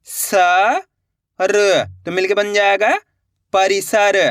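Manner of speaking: slow, then blend स र into परिसर; blending syllables. Shown as a demonstration. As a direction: sound out then join the word. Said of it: "मैं किस तरीके से एक ही अक्षर का उच्चारण करके इनको पढ़ रहा हूँ". (अक्षर मिलाकर शब्द बनाना)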